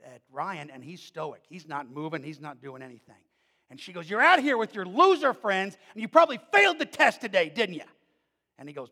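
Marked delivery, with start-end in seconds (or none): 4.1-7.9 loud, high scolding yell; angry mother. Retold or imitated. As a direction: berate him like a furious mom. Drunk mother yelling at son.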